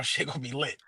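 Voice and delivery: ordinary talk, nothing marked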